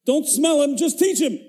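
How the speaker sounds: high, loud mock-offended reply; indignant parent. Parent firing back at the teacher, mock indignant and loud. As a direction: huff it out like an insulted parent. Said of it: "The parent writes back".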